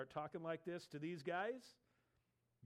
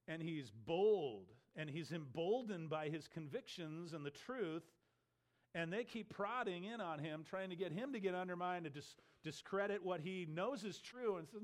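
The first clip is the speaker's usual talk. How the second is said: normal talking voice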